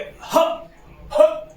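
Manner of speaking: ह pushed out high and loud from the throat; throat sound demo of ह; breathy h demo. Shown as a demonstration. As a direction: blast the ह from the throat. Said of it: "आवाज कहां से निकल रही है".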